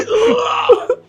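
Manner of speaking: high strained grunt of pain; pained groan. Retold or imitated. Thrown down by the pastor right after surgery, a pained high groan. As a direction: groan as if the stitches tear.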